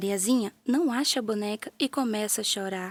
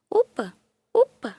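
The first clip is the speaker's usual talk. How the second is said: louder, rising hop calls; jumping cries; 3 semitones higher, 7 dB louder than usual. A narrator's reading. Doll jumping higher to see the kitten, straining cries. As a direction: push higher on each upa. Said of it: "pula cada vez mais alto".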